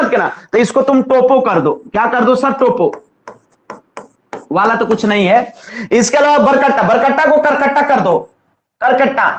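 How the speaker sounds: normal talking voice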